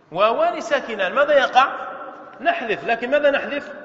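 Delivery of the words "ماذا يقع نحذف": usual speaking voice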